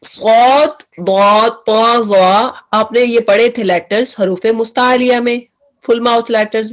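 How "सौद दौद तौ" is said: सौद दौद तौ वो each drawn thick; heavy letter demo. Shown as a demonstration. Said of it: "अब देखें कि".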